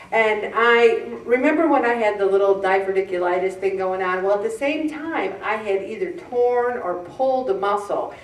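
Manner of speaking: ordinary talk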